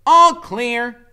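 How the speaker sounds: high, loud announcement; Freak's voice. A narrator's reading. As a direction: call it out like a lookout.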